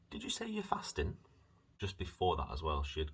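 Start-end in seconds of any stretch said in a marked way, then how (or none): 0.1-1.2 high imitation of mum; mum's voice. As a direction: mimic mum asking, pitched up high. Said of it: "My mum asked me before".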